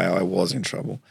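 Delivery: ordinary talk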